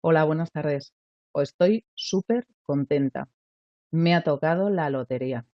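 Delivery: lottery win told in a low, calm, flat voice; deadpan, as if nothing happened; flat lottery news. Shown as a demonstration. Teacher demos telling the family flatly about a lottery win. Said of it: "lo cuente en casa diciendo".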